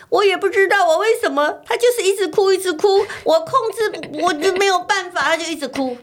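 put-on high, bewildered little child voice; child's voice. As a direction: imitate a confused little kid who can't stop crying. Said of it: "赶快跑过来说".

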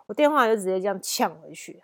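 usual speaking voice

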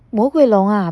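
plain reading voice

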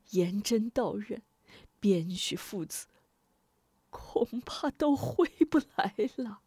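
soft, half-whispered hopeless lament; despairing sigh. A narrator's reading. Defeated official tells his wife all is lost, sighing softly. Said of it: "叹道".

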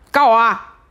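loud mock-defiant shout; joking dare. Real, not acted. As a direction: dare them, half joking. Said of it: "没有啦，我开玩笑啊". (半开玩笑地大喊挑衅)

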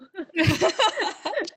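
bright high laugh; rising laugh. Real, not acted. Laughs after saying luck.

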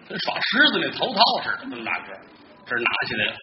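ordinary talk

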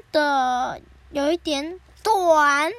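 短 stretched out long and very loud; stress on 短. Real, not acted. Host admits today's story is short, stressing 短. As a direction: draw out 短 loudly, slowing right down.

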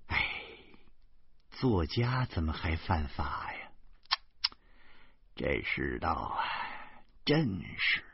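put-on low, soft old woman tutting with pity; old woman clicking her tongue in pity; regretful old lady. A narrator's reading. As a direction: sigh it like a gossiping granny. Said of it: "惋惜地说".

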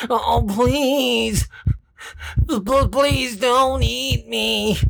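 high, stammering terrified begging; cowering plea. Acted. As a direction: beg for your life, stuttering. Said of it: "it begged for his life".

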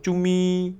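high, loud cutesy mirror voice; magic mirror. Acted. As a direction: gush flattery in a high loud squeal. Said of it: "魔镜这个时候就会说".

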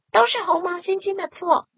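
高而响的群体怒喊; 一群动物一起冲着河马喊; 齐声吼叫. Acted. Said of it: "都生气地大声吼叫". (大声怒吼)

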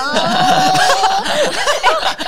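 high delighted laughter; long rising laugh. Real, not acted. Reacting to comeback story.

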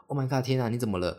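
quick, rising alarmed teacher voice; mock alarmed teacher. 模仿老师惊慌. (模仿老师惊慌地喊)

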